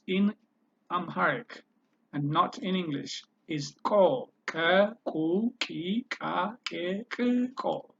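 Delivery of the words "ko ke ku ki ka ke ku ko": "ko, ke, ku, ki" with a clicked, popping K; click form of K. Shown as a demonstration. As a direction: click the K before each vowel of "ko, ke, ku". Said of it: "The click form of k".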